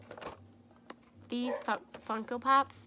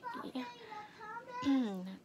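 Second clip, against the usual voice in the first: voice cracking up an octave; 11 semitones higher than usual. Real, not acted. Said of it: "My voice cracked funny".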